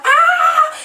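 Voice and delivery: piercing, very high loud scream. Retold or imitated. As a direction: shriek in terror. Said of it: "screaming like".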